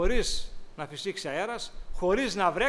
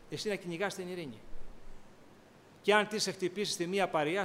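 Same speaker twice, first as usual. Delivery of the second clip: ordinary talk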